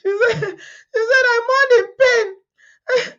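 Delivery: high, breaking laugh-cry through the words; crying with laughter. Real, not acted. Retelling the story to viewers, crying with laughter. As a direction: squeal it out through helpless tears of laughter. Laughing so hard she cries.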